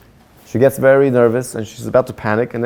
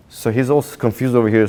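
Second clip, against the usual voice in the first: plain reading voice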